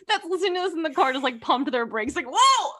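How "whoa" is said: high, loud yelp through laughter; mock startled yell. Imagined listener startled while driving, mock alarm. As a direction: yell as if slamming the brakes.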